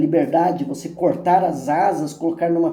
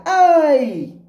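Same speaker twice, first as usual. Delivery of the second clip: high, loud echo of a pained yell; long falling shout, ringing back; echoed cry; 10 semitones higher, 6 dB louder than usual. A narrator's reading. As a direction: call it back like a mountain echo. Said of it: "escuta a voz se repetir".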